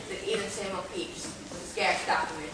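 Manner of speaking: ordinary talk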